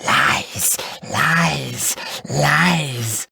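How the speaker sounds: hoarse, indistinct goblin taunt. A narrator's reading. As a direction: rasp it out like a hidden goblin jeering. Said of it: "a hoarse, indistinct voice".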